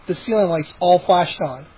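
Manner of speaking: usual speaking voice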